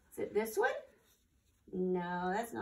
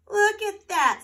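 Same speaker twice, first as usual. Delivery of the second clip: excited exclaim; 10 semitones higher, 14 dB louder than usual. Acted. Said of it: "said Hedgehog".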